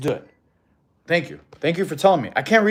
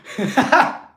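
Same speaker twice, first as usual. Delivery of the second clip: loud, high laugh; real high-pitched laughing fit; burst of laughter; 9 semitones higher, 5 dB louder than usual. Real, not acted. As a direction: crack up at a joke. Cracking up at a chat joke.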